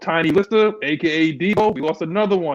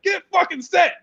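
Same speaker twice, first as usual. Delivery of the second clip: much higher-pitched retold yell; imitated quarterback yell. Fan imitates angry Matt Ryan on camera, high mock yell. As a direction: mimic an angry quarterback calling out. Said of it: "He was yelling out Matt Snoop".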